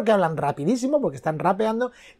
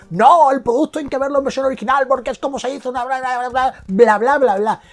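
mock-snobbish know-it-all voice, higher and louder; mocking snob; 5 semitones higher, 4 dB louder than usual. Ranting vlogger mimicking the purists who lecture him. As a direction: parrot the original-version purists with contempt. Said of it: "siempre te dicen".

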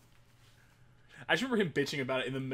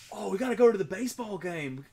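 put-on Australian accent; 6 semitones higher, 6 dB louder than usual. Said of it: "'Cause he's Australian".

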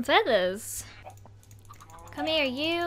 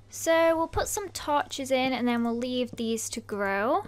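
usual speaking voice

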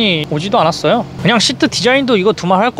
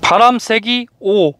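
slow, higher, carefully spaced words; very slow command; 5 semitones higher, much slower than usual. Shown as a demonstration. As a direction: spell it out slowly for the machine. Repeats after not understood.

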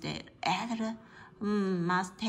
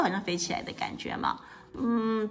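usual speaking voice

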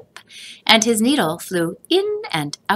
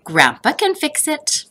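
put-on higher, eager child; a boy sure grandpa can help; child Joseph; 9 semitones higher than usual. A narrator's reading. Joseph answers his mother hopefully.

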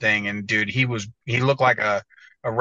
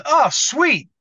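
much higher voice, louder, asking innocently; Hunter impression; 6 dB louder than usual. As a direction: squeak it out like the winner doesn't know. Hunter told he won.

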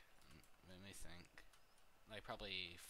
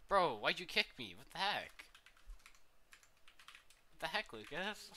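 loud, high indignant yelling; outraged yell; 7 semitones higher, 8 dB louder than usual. Real, not acted. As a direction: shout it out, betrayed. Kicked from game, outraged.

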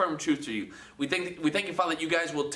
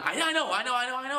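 excited insisting; 7 semitones higher, faster than usual. Retold or imitated. Voicing an excited disciple.

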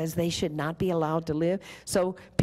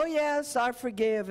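breezy, much higher mock "oh yes, I forgive"; a hammed-up glib believer; glib mock forgiveness; 7 semitones higher than usual. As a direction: toss off forgiveness lightly, too easily. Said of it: "very glibly go".

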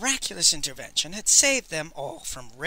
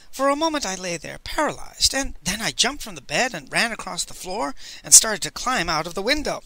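usual speaking voice

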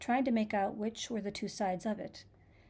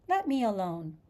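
mildly indignant, louder command; louder, put-out demand to be left be; 5 dB louder than usual. A narrator's reading. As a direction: push it out with some indignation. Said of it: "said Alice indignantly".

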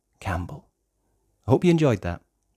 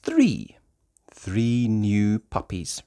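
ordinary delivery

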